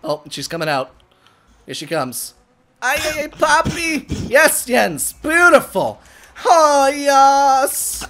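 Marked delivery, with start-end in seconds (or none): 2.8-8.1 loud, raised, celebratory calls; exuberant cheering. Real, not acted. Player praising Jens's play, loud exuberant cheer. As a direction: whoop it up for your teammate.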